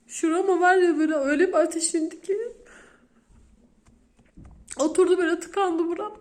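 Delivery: reliving the panic, throat tightening; choked-up distress. Real, not acted. Mother telling viewers her son vanished, high strained distress. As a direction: voice pushed high and strained, near tears.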